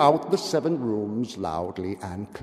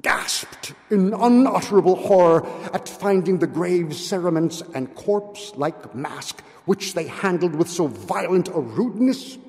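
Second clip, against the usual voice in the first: usual speaking voice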